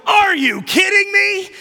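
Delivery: loud, high, indignant yell; mock outburst. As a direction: blurt it out like an outraged teenager. Flesh wants to react angrily.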